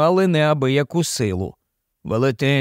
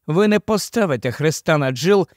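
ordinary delivery, nothing marked

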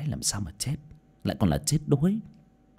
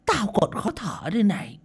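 put-on coughing, higher elderly voice; old woman medium, uneasy; 7 semitones higher, 3 dB louder than usual. A narrator's reading. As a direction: choke on the heavy air as an old seer.

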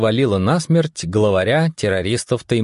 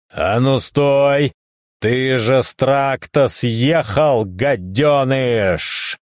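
drawn-out, put-on bellow without full volume; roaring stranger; 3 semitones higher, slower than usual. A narrator's reading. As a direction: stretch the scolding out like an echoing roar. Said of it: "Незнакомый голос невдалеке вдруг рыкнул так".

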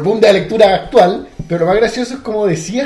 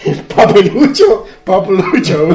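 playful; 7 dB louder, slower than usual. Pretend. Playful mangled word.

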